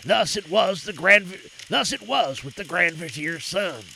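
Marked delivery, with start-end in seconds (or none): none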